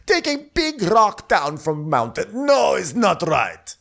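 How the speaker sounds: high, squeaky mock outrage turning to cooing; hammed-up shrieky doting suitor; Andrea. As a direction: burst out loud, then fuss in a high put-on voice. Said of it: "and Andrea's like".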